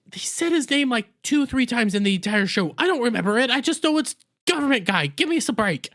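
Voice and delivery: high-pitched exasperated protest. Real, not acted. Defensive about forgetting a name.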